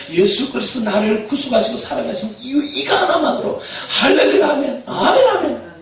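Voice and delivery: loud, high, building to laughing amens; fervent preaching. Acted. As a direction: preach it with rising fire. Preaching at full force. (점점 고조되는 열정적인 설교)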